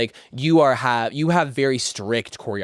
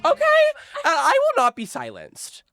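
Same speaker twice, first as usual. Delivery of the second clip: much higher, laughing outburst; laughing excitedly. Real, not acted. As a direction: squeal it through real laughter. Bursting out laughing, defiant joke.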